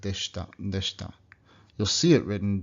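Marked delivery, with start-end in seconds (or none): 0.0-1.1 "deshita" said as "deshta", the i gone; dropped i. Shown as a demonstration. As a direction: swallow the i in "deshita". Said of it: "sounds like".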